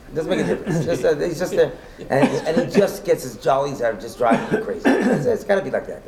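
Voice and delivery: laughing through the words about the nudnik; amused, chuckling while describing him. Real, not acted. Rabbi laughs telling students about the nudnik, laughing.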